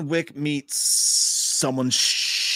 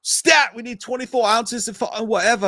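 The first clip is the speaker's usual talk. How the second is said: urgent high mock London medic; hammed-up London emergency call, pitched high; bad London accent; 9 semitones higher, 4 dB louder than usual. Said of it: "the worst fucking London accent".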